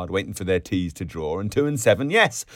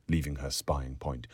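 usual speaking voice

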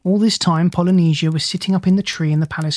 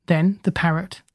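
ordinary delivery

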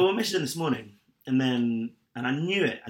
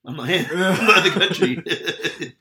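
laughing; 4 semitones higher, 9 dB louder than usual. Retold or imitated. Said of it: "I was like".

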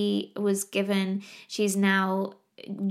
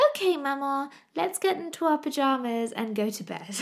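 put-on sweet toddler voice; 6 semitones higher than usual. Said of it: "all I want her to do is go".

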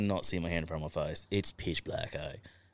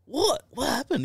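high mock bewildered what; hammed-up confusion; confused. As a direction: play the clueless guy, pitched way up. Retold own confused reply.

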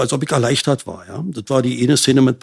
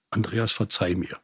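quiet retold plea; 4 semitones lower, 9 dB quieter than usual. Mother's plea, voiced in story.

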